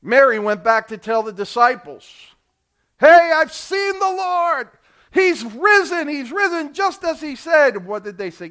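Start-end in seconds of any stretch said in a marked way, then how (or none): 3.0-7.8 high, loud joyful announcement; excited shout. Acted. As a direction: burst out with the news. Mary telling disciples excitedly.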